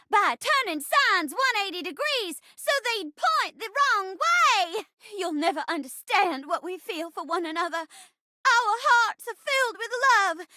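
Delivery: high, theatrical heroine pleading her case; melodrama heroine. Acted. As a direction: play an overwrought heroine, pitched high.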